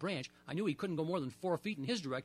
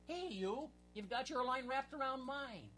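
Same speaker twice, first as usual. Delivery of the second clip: much higher, slow reproach; mild shout; 8 semitones higher, 4 dB quieter, slower than usual. Acted. Portry shouting at his friend about tangled lines. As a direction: call him out, drawn out. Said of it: "Portry shouted".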